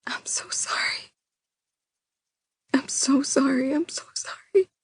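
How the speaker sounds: tearful repeated whisper. Acted. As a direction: breathe the apology out, voice gone. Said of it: "I whisper".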